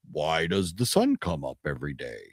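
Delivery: hint of a wise old mentor; lightly voiced Connery. Fan repeats the cheesy movie line, softly and low. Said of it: "he asks him".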